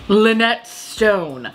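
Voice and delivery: very slow, savoured "Lynette Stone"; reading a name out. Real, not acted. Reading the lipstick shade names to viewers, slow and savoured. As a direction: draw the name out slowly like a grand title. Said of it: "Let me read you some of them".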